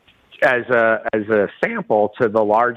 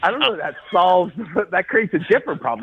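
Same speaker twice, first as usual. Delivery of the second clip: high, laughing disbelief at the snake idea; 6 semitones higher than usual. Retold or imitated. To the farmer about snakes, laughing disbelief. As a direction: giggle through it, half incredulous. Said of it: "And I go".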